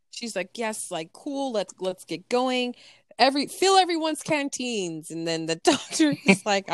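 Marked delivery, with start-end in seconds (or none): 0.6-5.1 higher-pitched, excited orders; hyped. Retold or imitated. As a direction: imitate someone eager to get going. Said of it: "she's so hyped".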